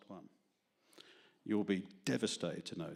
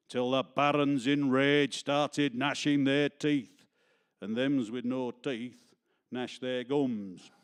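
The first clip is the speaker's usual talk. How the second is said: louder, hammed-up Yorkshire-Lancashire monologue recital; cod Yorkshire accent; 5 semitones higher, 9 dB louder, slower than usual. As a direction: declaim the comic verse in a mock Yorkshire accent. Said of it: "with a cod Yorkshire accent".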